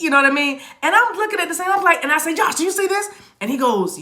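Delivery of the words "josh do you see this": high, astonished recreation; retold amazed question. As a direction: call his attention to an unbelievable sight.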